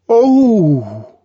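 high loud gasp of surprise; sudden awed gasp; startled gasp. A narrator's reading. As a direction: gasp at the sight of her. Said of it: "He gave a gasp".

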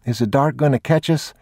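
put-on high child's voice; anxious little boy, pitched high; young boy. A narrator's reading. Boy worried about the dark, high childlike voice. Frightened boy asks his father.

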